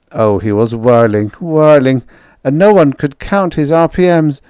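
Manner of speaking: plain reading voice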